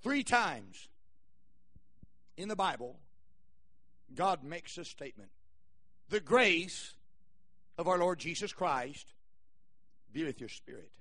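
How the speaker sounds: hushed, low, with long pauses; solemn scripture recital. Real, not acted. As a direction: let each phrase of the verse settle. Solemn Bible quote to congregation.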